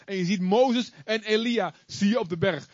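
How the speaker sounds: ordinary talk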